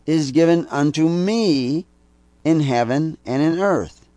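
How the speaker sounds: preached Bible quote, slow and lifted; scripture reading. Acted. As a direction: proclaim the verse from the pulpit. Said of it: "It's Matthew twenty-eight eighteen".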